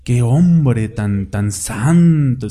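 very slow, drawn-out mock admiration; a light touch of dreamy worship; mock awe. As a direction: stretch it out like a gawking admirer.

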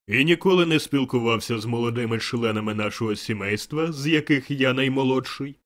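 loud, booming interrogation; insistent questioning. A narrator's reading. The spirit questioning Scrooge about its brothers, insistent booming voice. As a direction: press the question at full volume. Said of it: "допитувався дух".

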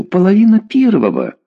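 high-pitched indignant exclamation; outraged scolding. A narrator's reading. Mother telling daughter how late it is, outraged. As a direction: throw it out in exasperation. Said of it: "набросилась на Лену".

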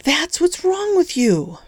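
high, slow shouted accusation; shouting. A narrator's reading. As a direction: daughter yelling at her father. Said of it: "he could hear Vicky shouting".

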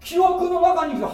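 emphatic preaching. Acted. Preacher crying out to the congregation, loud and high. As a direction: hammer 記憶の中に生きて home like a revival preacher. Same phrase repeated louder.

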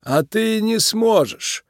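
high, slow, icy creak; cold creaky boss. A narrator's reading. Mob boss dismisses Nick's plea, cold and creaky. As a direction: drag it out coldly. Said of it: "холодно сказал мистер Биг".

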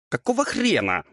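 high-pitched affronted question; indignant devil. A narrator's reading. Devil splattered with eggs protests, indignant. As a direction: squeal of mild outrage. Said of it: "возмутился обляпанный взбитыми яйцами чёрт".

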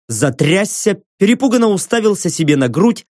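usual speaking voice

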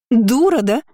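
high, indignant yell; shouting. A narrator's reading. Kiora insulting her cousin, shouting. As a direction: cry out with pitch jumping up. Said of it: "закричала Киора".

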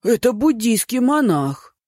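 high, slow shocked cry; surprised exclamation. A narrator's reading. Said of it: "воскликнул старшина Хун".

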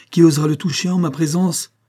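high, loud, indignant question; defiant challenge. A narrator's reading. Niece shielding her future husband from uncle, defiant challenge.

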